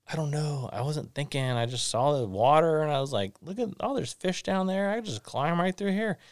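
put-on high-pitched little boy explaining himself; excited child's excuse. Son explaining to parent about fish below, excited high voice.

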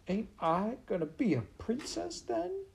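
very high-pitched, plaintive maid voice; dismayed serving girl. Acted. Maid asking if she'll be a princess, high and plaintive. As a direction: squeak it out like a disappointed simple girl.

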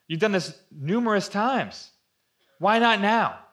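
exasperated challenge. Pretend. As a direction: demand it, baffled he stays silent. Preacher challenging Jesus in exasperation.

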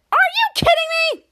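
high furious outburst, loud. Acted. Charlotte furious at Jasper after the jar breaks. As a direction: blow up at him in disbelief. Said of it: "with furious".

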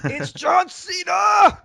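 high, slow hollered name; ring announcer shout. Pretend. Host shouts the wrestler's intro announcer-style.

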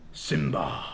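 deep, slow lion king's call; Mufasa voice. Acted. Mufasa calling to his son Simba, deep and solemn. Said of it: "I'm gonna do my best Mufasa voice".

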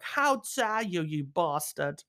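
slow whiny mock hurt voice; mock offended. Mocking [name] being offended, whiny. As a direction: play a precious wounded man. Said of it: "being offended by a joke".

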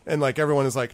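usual speaking voice

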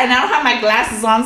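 ordinary talk

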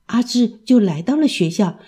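ordinary delivery, nothing marked

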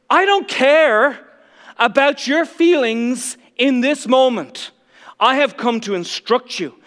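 loud, slow, unyielding declaration; resolute Jesus. Retold or imitated. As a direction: refuse to yield an inch. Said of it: "He doesn't skip a beat".

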